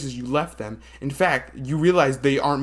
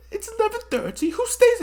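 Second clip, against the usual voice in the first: high character voice. Acted. Voicing a character.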